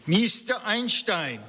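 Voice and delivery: put-on high, drawn-out call in a Central European accent; old woman's Central European accent, pitched high; strong Central European accent. A narrator's reading. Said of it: "she called out in a strong Central European accent".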